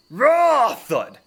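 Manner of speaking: very loud, high roar ending in a thud; explosive imitated crash; roared sound effect. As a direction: bellow the noise of a distant fight. Game master imitates noise heard offstage.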